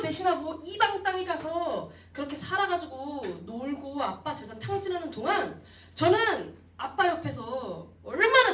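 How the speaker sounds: loud, high indignant protest; a wounded son finally exploding; angry outburst. Acted. Elder son yelling his grievance at his father, furious. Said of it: "라고 분노하고 있는 거예요".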